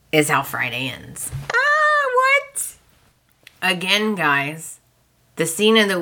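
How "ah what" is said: very high squeal of disbelief; shocked reaction. Retold or imitated. Fan reacting to how Friday ends, shocked.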